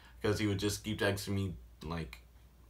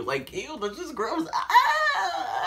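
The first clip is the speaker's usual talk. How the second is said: high laughing shriek of disgust; laughing squeal; 3 dB louder, much slower than usual. Real, not acted.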